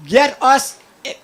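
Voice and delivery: usual speaking voice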